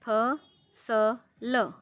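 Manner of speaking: फ स ल spelled out very slowly, letter by letter; sounding out letters. Shown as a demonstration. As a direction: pause between each sound of फ स ल. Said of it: "पहला है".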